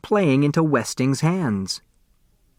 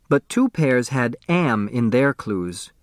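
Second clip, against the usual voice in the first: ordinary delivery, nothing marked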